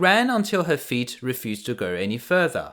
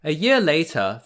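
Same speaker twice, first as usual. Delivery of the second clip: ordinary delivery, nothing marked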